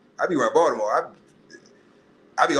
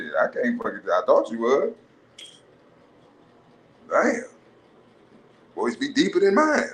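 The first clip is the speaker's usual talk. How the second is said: stunned guy. Retold or imitated.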